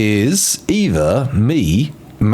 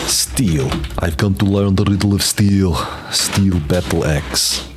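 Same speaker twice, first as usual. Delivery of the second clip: epic warrior voice. Pretend. Reading crafting menu in epic voice.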